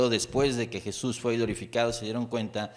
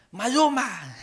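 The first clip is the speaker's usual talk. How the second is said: high, loud fan yell; shouting a name; 10 semitones higher, 4 dB louder, slower than usual. Retold or imitated. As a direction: call out the star's name from the crowd. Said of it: "un palero ahí le grita".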